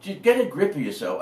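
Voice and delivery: usual speaking voice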